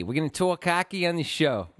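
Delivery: attempted Chicago accent, vowels off; hammy Chicago-accent announcement; put-on Chicago accent. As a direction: repeat it in a badly faked Chicago accent. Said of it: "You shouldn't do an accent".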